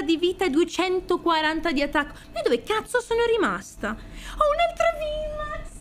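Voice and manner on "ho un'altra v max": excited. Real, not acted. Another V max pulled.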